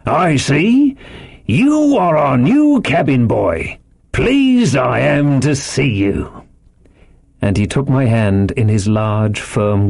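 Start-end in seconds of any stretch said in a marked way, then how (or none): 0.1-6.4 slow, high, hearty sailor welcome; raised, stretched-out landlord's greeting; quite loud. A narrator's reading. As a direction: draw it out for the whole room. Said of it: "quite loud".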